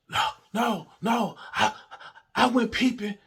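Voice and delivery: high, stammering mock denial; flustered denial. Partner's denial acted out.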